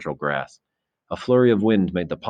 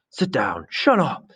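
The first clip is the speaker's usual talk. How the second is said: high-pitched heckling cries; storm of protest; 8 semitones higher, much slower than usual. A narrator's reading. Assembly rounding on Simon, angry high-pitched outcry. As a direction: boys shouting someone down, much higher. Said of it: "the storm broke".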